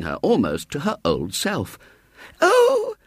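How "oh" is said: put-on high, tearful cry of a child; crying little girl. A narrator's reading. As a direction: sob it out in relief to her mother. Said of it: "said Violet Elizabeth".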